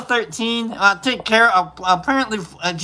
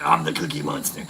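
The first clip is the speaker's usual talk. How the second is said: low, softer monster impression; Cookie Monster; 6 semitones lower, 6 dB quieter than usual. Streamer recalling the failed Japanese accent, mock monster voice. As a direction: lightly imitate a bad accent turning into a monster voice. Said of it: "and it turned into".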